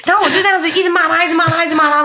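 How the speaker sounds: high, fast laughing retelling; high laughing chatter. Real, not acted.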